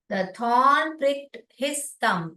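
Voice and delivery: sentence read out very slowly, word by word; slow blended reading. Shown as a demonstration. Teacher reading the sentence aloud for the class, slow. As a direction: read it slowly so they can follow. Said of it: "we are able to blend and read".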